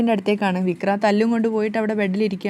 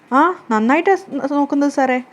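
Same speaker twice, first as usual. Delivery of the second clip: higher and louder, nervously assuring the police; flustered fear; 5 semitones higher, 4 dB louder than usual. Retold or imitated. Vikram answering the police, higher, louder and flustered. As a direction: blurt it out in a panic. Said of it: "വെപ്രാളത്തോടു കൂടി ... പേടിയിലാണ്".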